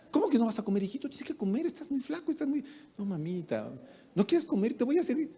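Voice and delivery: high, fussing motherly insistence; a doting mother pushing food on her thin son; insistent mother. Retold or imitated. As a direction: nag him lovingly to eat.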